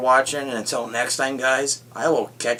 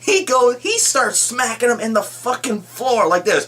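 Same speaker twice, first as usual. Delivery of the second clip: much higher and louder, bursting with glee; real fan excitement, pitched way up; thrilled; 11 semitones higher, 4 dB louder than usual. Real, not acted. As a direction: gush about the best part. Thrilled retelling of Hulk scene.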